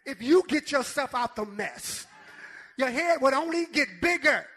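loud, rhythmic pulpit delivery; punchy shouted sermon cadence; full-force preaching. Acted. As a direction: hammer each phrase home to the congregation.

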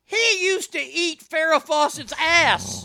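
high, loud, drawn-out declaration; heated insistence. Real, not acted. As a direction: proclaim it slowly in indignant defence. Heated boast in the actor's defence.

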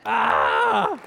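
high, loud mock wail "aah"; dismayed cry. A kid caught out by the parents' question, dismayed cry.

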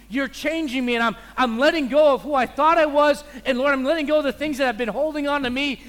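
loud pleading prayer to the Lord; impassioned prayer. Retold or imitated. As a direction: pour your heart out to God, loudly. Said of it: "And you go".

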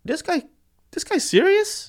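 high-pitched disbelief; incredulous. Retold or imitated. Driver reacting to the burnout box order, incredulous. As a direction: retell it in a squeaky, can't-believe-it voice.

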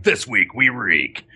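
slow, drawn-out spot announcer delivery; promo spot read. Acted. Voice actor recording a show's spot, slow promo voice. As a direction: stretch it out like a show promo. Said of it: "spot for him, like".

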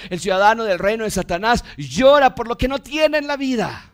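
insistent exhortation building to a high peak; fervent pulpit voice; impassioned preaching. Real, not acted. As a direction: drive the sermon up to a ringing climax.

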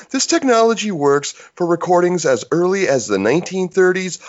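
polished commercial announcer voice; ad read. Acted. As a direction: sell it like a radio ad. Full mock ad read.

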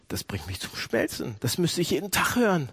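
ordinary talk, nothing marked

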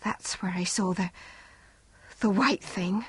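soft, uneasy voice; wife tells husband where, quietly uneasy. A narrator's reading.

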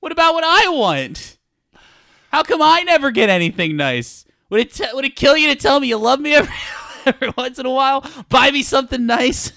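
high-pitched whiny spouse complaining; shrill nagging. Pretend. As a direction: nag like a neglected partner. Mock spouse quarrel.

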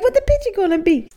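put-on high, wondering kid voice; curious kid. Host imitating a child at the dot worksheet, wondering. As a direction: ask it like a puzzled little kid. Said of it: "You're like".

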